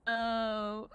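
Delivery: low, drawn-out "oh"; retold deadpan "oh". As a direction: mimic her reaction, stretching the one word. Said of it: "when she said it that way".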